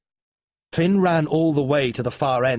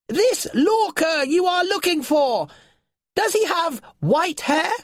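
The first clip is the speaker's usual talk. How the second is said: calling creature; 4 dB louder than usual. A narrator's reading. Nylar calling down to Finn.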